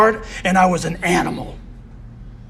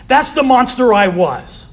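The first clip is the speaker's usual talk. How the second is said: normal talking voice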